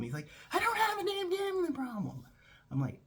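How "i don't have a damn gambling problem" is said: much higher, exasperated denial; indignant denial. Retold or imitated. Boss denying a gambling problem on the phone, indignant. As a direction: protest it in a put-on high voice.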